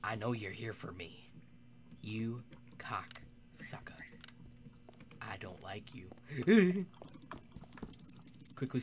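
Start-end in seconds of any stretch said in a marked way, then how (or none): 2.0-6.1 slow hissed whisper; whispered insults. Real, not acted. Player whispering insults at a hunter. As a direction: whisper the abuse slowly.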